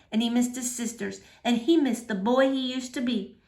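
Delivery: ordinary delivery, nothing marked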